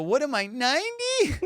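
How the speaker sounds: high, drawn-out incredulous joke; mock indignation. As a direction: imitate her, slow and very high. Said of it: "she would say that every time".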